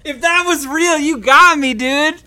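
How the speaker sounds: triumphant gotcha, laughing at wife; excited insisting. Real, not acted. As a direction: call her out with delight. Insisting her laugh was real.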